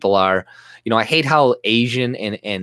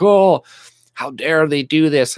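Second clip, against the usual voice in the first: high, loud shocked gasp of fake indignation; mock outrage; 5 semitones higher, 4 dB louder than usual. Mocking critics of the mosque walkers with mock outrage. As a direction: overplay the pearl-clutching.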